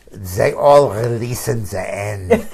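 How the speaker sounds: put-on slow, loud, laughing German accent; mock German accent. Advising the counsel to release, in mock German accent. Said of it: "put on my best German accent".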